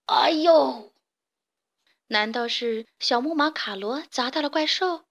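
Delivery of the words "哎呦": a hoarse, pained yelp from far below; hoarse ouch. A narrator's reading. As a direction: cry out hoarsely as if something hit you. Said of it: "沙哑的声音". (沙哑的“哎呦”痛叫)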